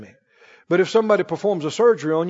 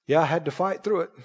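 low, quiet mock modesty; soft, low boast, thrown away; self-crediting worldly person; 7 semitones lower, 10 dB quieter than usual. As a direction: act out understated self-congratulation. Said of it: "ungodly world, they don't talk like that".

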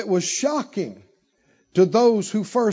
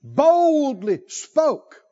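high, hugely stretched BOLDLY; bold preaching; 7 semitones higher, 4 dB louder, much slower than usual. Shown as a demonstration. Preacher urges speaking to sickness, bold and drawn-out. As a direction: draw the first word out high and firm. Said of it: "Wonder if you boldly spoke".

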